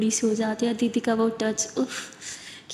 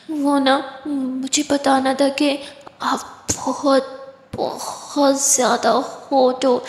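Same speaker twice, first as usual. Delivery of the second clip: tipsy flirt; 2 semitones higher, much slower than usual. Acted. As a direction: stretch every word, heavy with drink. Drunk whisper into ear.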